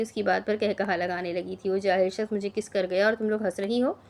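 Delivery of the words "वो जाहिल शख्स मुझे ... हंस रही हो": ordinary delivery, nothing marked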